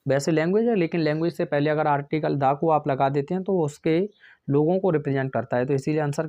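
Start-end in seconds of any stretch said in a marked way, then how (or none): none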